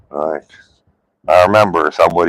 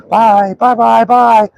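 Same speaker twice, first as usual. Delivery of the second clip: squeaky high singsong bye-bye, a bit louder; singsong goodbye; 5 dB louder, slower than usual. Retold or imitated. Owner calling goodbye to dog chasing bike, squeaky high singsong. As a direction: call it sweetly up high to the dog. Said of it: "I was driving down the street like".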